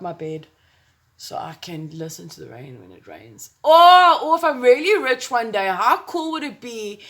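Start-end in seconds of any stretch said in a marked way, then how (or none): 3.6-7.0 loud, high burst of excitement; excited. Real, not acted. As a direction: blurt out a sudden happy idea. Sudden dreamy idea burst.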